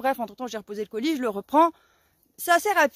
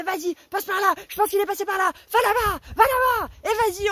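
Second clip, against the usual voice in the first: shouted directions; 7 semitones higher than usual. Retold or imitated. Directing the neighbour in the chase, shouting excitedly. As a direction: bark the route at the driver.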